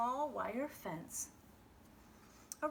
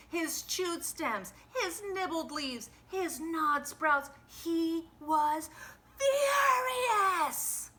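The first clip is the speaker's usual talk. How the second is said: rising to a high, loud crying shout on furious; furious build; 8 semitones higher, 6 dB louder, slower than usual. A narrator's reading. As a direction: climb into a shout of fury. Narration rises to fury.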